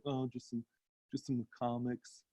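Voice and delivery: imitating a shy kid owning up quietly; sheepish admission. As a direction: say it small, hoping nobody hears. Shy kid admitting his secret hobby.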